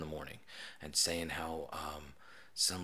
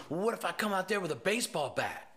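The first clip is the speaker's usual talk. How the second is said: mock kid; 10 semitones higher than usual. Kid threatening through door.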